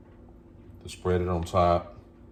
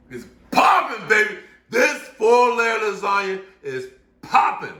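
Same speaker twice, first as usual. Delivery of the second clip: much higher, louder squeal of delight; ecstatic; 13 semitones higher, 6 dB louder than usual. Real, not acted. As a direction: gush like it's the best thing ever. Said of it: "I get excited about this".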